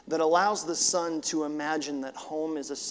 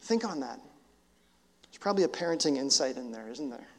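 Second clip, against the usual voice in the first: ordinary talk, nothing marked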